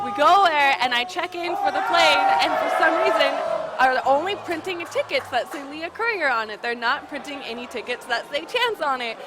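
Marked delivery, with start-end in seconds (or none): 0.0-6.3 raised, louder retelling of the airport mess; loud and exasperated. Real, not acted. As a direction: push the voice up in frustration. Shouting while retelling chaos.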